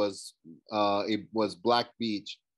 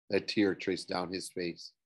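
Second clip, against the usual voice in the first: ordinary delivery, nothing marked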